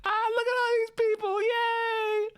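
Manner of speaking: a hyper dog yapping at new people; excited little dog. Retold or imitated. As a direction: bounce around like a hyper puppy. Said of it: "I'm like".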